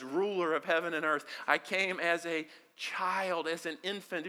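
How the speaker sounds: ordinary delivery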